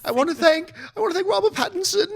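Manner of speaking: put-on high-pitched English fangirl accent; squeaky English accent, imitating an emotional fan; English accent impression. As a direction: gush thanks in a high mock-English voice. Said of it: "she's from England. She's got this accent".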